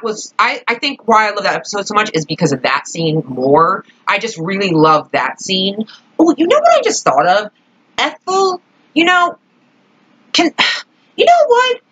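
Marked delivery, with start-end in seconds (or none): 6.2-11.8 high excited burst of a new thought; sudden realization. Real, not acted. Vlogger suddenly remembering a creepy character, excited high burst. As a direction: it just hit you, blurt it out high.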